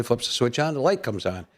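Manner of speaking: normal talking voice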